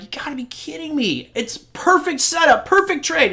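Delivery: high-pitched incredulous retelling; mock disbelief. Retelling his frustration at losing trades.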